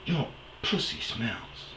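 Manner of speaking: slow, breathy hushed jab; hushed insult. Retold or imitated. Comic to ex during fight, slow hushed insult. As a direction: drop the nuke quietly. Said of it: "And I'm like".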